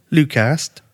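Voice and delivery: usual speaking voice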